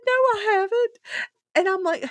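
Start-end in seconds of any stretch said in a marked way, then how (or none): 0.1-1.0 very high and loud mock denial; hammed-up flat denial; incredulous denial. Daddy denies it in [name]'s high, laughing retelling.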